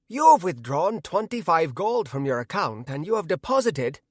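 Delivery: high-pitched yet gravelly Irish voice; angry little leprechaun reciting instructions. A narrator's reading. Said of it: "in an Irish accent and high-pitched yet gravelly voice".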